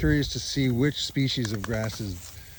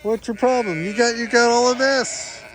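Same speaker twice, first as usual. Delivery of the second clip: higher, louder teasing scold; mock scolding; 10 semitones higher, 6 dB louder than usual. Real, not acted. Scolding the sheep.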